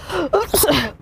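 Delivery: acted sneeze; fake sneeze. Shown as a demonstration. Teacher demonstrates sneezing with food in the mouth, fake sneeze. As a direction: let out a pretend sneeze. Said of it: "and you just sneeze".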